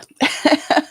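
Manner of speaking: short breathy chuckle; real laugh. Real, not acted. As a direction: laugh it off briefly. Host laughs at her own lateness.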